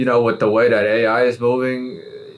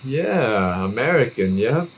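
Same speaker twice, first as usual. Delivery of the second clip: imitating the agent; 3 semitones higher, much slower than usual. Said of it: "They were like".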